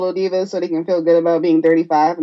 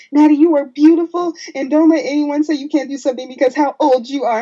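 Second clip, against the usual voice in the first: higher-pitched, emphatic motivational speech; mock-heroic encouragement; rousing pep speech; 8 semitones higher, 4 dB louder than usual. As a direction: imitate a fired-up wrestler's pep talk. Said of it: "this John Cena-esque speech".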